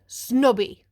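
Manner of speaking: Snobby said loud as a call-and-response cue; model word for the kids. Shown as a demonstration. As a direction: say Snobby out loud for the class to repeat. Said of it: "Everybody say".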